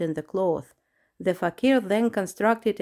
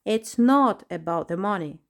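plain reading voice

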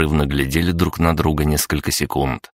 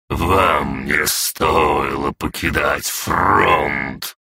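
slow, heavy, threatening voice; menacing wolf king; much slower than usual. Acted. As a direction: warn him with slow menace. Wolf king warns the councillor.

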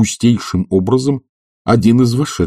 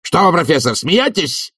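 high-pitched affronted question; indignant; 11 semitones higher than usual. A narrator's reading. Committee head thinks the professor mocks him, indignant. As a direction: take offence, voice rising high. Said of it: "возмутился Швондер".

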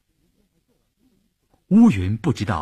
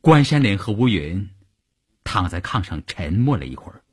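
ordinary delivery, nothing marked

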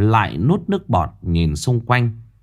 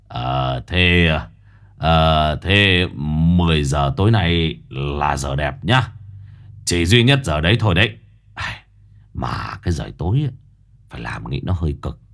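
lower, hesitant wheedling; hint for more money, hemming; sly old master; 6 semitones lower than usual. Acted. Quý asking for money.

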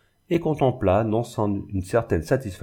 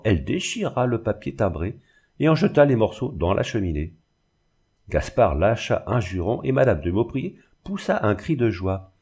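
ordinary delivery, nothing marked

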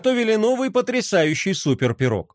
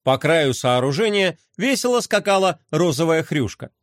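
ordinary delivery, nothing marked

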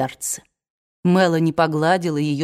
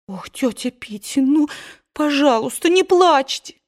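higher, pleading, rising to a cry; pleading young woman; 8 semitones higher than usual. A narrator's reading. Scarlett begs her sobbing aunt, higher pleading. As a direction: beg her to stop crying.

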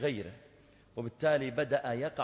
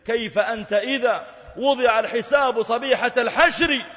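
much higher, louder, voice nearly breaking; impassioned cry; 10 semitones higher, 11 dB louder than usual. Real, not acted. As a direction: cry it out over the Day of Judgment. Peak of verse, emotional. (صرخة واعظ متأثر)

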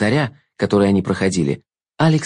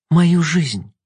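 put-on higher sharp girl's retort; 6 semitones higher than usual. A narrator's reading. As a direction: correct him like a stubborn girl. Girl retorts.

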